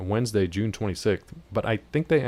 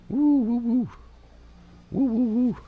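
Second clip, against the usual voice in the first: high hooting woo; 14 semitones higher, slower than usual. Pretend. Mimics stress of a show.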